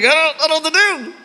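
high, fast panicky mock-helpless; panicked. Pastor retelling himself stuck on the roof, panicked. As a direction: blurt it out like a man frozen on a roof.

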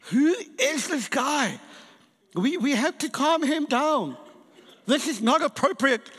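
high, indignant complaint to the pastor; mock outrage at the noisy worshipper; offended. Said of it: "I was so offended".

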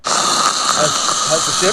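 breathy zombie voice; zombie groan. Pretend. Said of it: "That's a great zombie sound".

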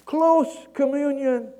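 high, loud, drawn-out answer; yelling down. Retold or imitated. Man on the bridge gives his last answer, yelling down. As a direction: call it down slowly. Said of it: "the guy yells down".

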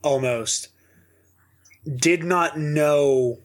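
ordinary talk, nothing marked

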